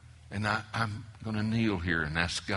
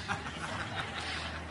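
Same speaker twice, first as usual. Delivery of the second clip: a quick airy laugh at his own joke; breathy laugh; 8 semitones higher, 8 dB quieter than usual. Real, not acted. Preacher laughing at his own story, airy chuckle. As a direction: let a soft unvoiced chuckle escape.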